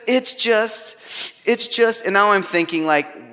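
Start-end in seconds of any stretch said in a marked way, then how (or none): 0.1-2.0 high, halting mock-crying voice; high-pitched imitation of choking up; imitating tearful sobbing. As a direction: play a crying kid stuck on his words. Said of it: "he's crying and crying".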